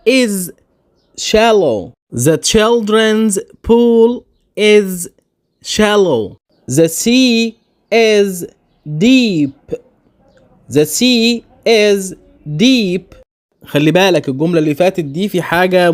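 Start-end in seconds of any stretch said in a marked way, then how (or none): 2.1-6.4 put-on the children's pool is, very slow; slow example. Shown as a demonstration. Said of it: "أمثلة". 6.7-9.8 the sea is, very slow; slow example. Shown as a demonstration. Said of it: "أمثلة". 10.8-13.2 the sea is, slow again; spoken slowly for learners; slow example. Shown as a demonstration. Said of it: "أمثلة".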